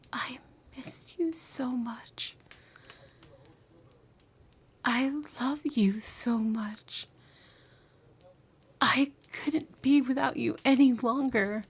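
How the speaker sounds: drawn-out, aching whisper; slow longing whisper. Acted. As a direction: breathe the longing slowly into the ear.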